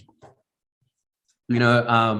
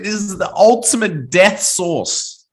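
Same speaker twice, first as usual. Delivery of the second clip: dramatic announcement; 7 semitones higher, 3 dB louder, slower than usual. Acted. Dramatic intro of hottest sauce.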